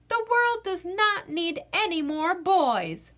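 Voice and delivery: put-on girl declaring about the frogs; proclaimed. A narrator's reading. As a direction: proclaim it like a decree. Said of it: "Maggie proclaimed".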